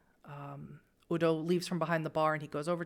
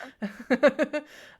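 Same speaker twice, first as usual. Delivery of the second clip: loud, higher-pitched laugh; 7 semitones higher, 9 dB louder than usual. Real, not acted. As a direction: burst out laughing. Table jokes about the husband.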